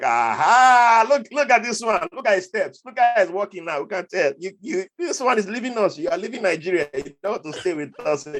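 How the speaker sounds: police jeering at him outside the embassy; teasing Nigerian police. Retold or imitated. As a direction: needle him like a jeering cop. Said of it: "They're like".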